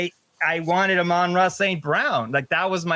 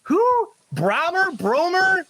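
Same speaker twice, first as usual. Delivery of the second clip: high-pitched, mock-baffled questioning; incredulous; 12 semitones higher than usual. Host baffled by the Vikings' starting quarterback, high incredulous squeal. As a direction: squeal each question in exaggerated disbelief.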